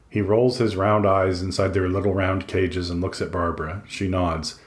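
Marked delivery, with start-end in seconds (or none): none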